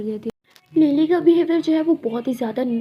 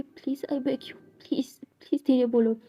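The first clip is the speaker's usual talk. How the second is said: unvoiced, desperate begging whisper; whispered pleading; 3 dB quieter than usual. Lakshmi begging Lily quietly so classmates won't hear. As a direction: beg her in a whisper to keep it down.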